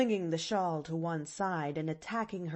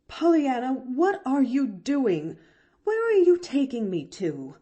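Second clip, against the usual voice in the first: startled, higher and louder protest; recoiling; 6 semitones higher, 6 dB louder than usual. A narrator's reading. Aunt being pulled toward the sun parlor, recoiling in alarm. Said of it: "recoiled Aunt Polly".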